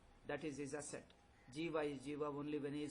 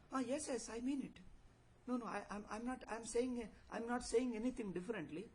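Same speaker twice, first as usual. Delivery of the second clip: higher-pitched acted protest; insistent protest; 5 semitones higher than usual. A mortal defends his claim to be eternal, insistent. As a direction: protest in a hurry to defend yourself.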